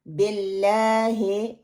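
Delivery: slow BELLAAHE for बिल्लाही, E in place of I; wrong vowels. Shown as a demonstration. Teacher marking the mistake, slow BELLAAHE. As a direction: spread the first and last vowels of बिल्लाही. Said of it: "गलत है".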